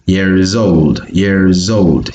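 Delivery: put-on "years old" run together with a Z into the O; linked words. Shown as a demonstration. Teacher drilling the connected sound, linked pronunciation. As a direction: link "years old" like "year-zold". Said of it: "is being connected to this O".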